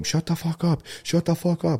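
high mock yell bursting into the room; retold scream. Friend bursts in yelling at the group, mock scream. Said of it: "comes in screaming in the room".

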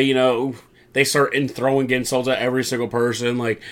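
usual speaking voice

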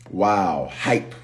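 ordinary talk, nothing marked

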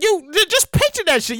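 normal talking voice

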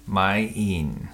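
"Mine" stretched into two beats, like "ma-een"; odd pronunciation. Real, not acted. Co-host saying the drama title his own odd way, stretched out. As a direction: draw "Mine" out with a strange vowel. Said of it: "Why do you always say that weird?".

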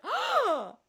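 high startled "Oh!"; sharp, high gasp of surprise; surprised gasp. Retold or imitated.

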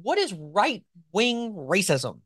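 very slow, word by word; slow for a tongue twister. Real, not acted. Host working through a tongue twister, slowly. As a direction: ease through each word so you don't trip. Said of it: "having to say it slowly because it is a tongue twister".

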